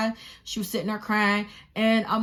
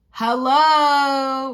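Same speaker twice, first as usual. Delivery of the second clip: loud, higher, long-drawn hello; indignant call-out; 5 semitones higher, 8 dB louder than usual. Retold or imitated. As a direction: call out to people ignoring you. Acts calling bystanders for help.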